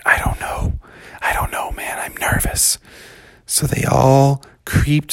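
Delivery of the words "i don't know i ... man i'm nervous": put-on hushed kids whispering in fear; nervous whisper. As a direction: whisper it, uneasy. Frightened kids at the cave.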